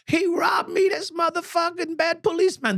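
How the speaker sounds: mock her shrill accusation; yelling woman. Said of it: "she's yelling out".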